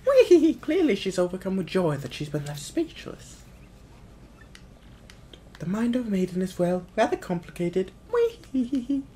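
high-pitched flattering servant voice; squeal, then explain it in a high voice; high cartoon sidekick; cackling minion. Voices Bowser's minion in game.

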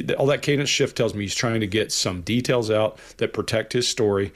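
ordinary talk, nothing marked

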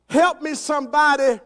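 loud, high cry for rescue; acted holler for help; yelling for help. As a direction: call out as if stranded on a cliff. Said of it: "he yells out".

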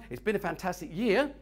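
usual speaking voice

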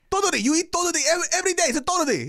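high, loud, insistent mother voice; imitated mad mom. Mom insisting son knows the snack, getting mad. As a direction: imitate a mom rattling it off, exasperated. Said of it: "she's getting mad".

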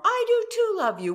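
high, loud, fast protest, hammed up; mock defensive outburst. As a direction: play the stung partner snapping back. Acting a couple's quarrel.

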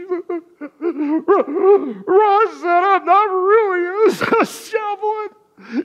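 put-on crying child. Little sister sobbing to Mom that she was found by the road. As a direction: sob it out between broken repeats.

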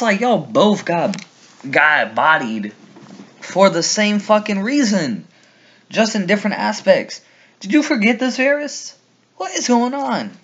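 high, incredulous rant; exasperated disbelief. Real, not acted. Fan scolding Varys for forgetting Ned's fate, high and exasperated. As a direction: plead in high-pitched bafflement.

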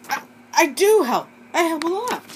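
high, loud hurt protest; indignant protest. Acted. Accused teammate protesting to the team, loud and high indignant. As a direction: fight back against the accusation.

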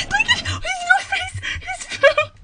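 high loud laughing squeal; squealing with delight. Real, not acted. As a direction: shriek over his cute face. Squealing at his little face.

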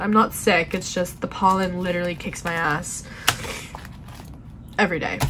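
usual speaking voice

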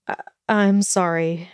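usual speaking voice

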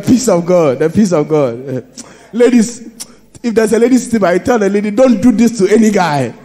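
loud, high, laughing delivery; laughing preacher. Real, not acted. Preacher amused telling congregation about the man, laughing loud.

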